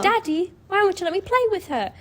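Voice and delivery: put-on high, quick little British girl voice, pleading. Little girl begs her daddy to let her play, high British child voice. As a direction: squeak it like a small British child. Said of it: "the little girl was British".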